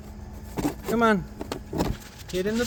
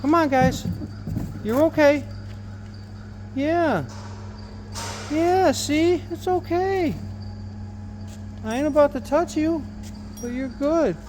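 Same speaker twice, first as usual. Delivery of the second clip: soothing a scared animal; 9 semitones higher than usual. Real, not acted. Soothing trapped birds.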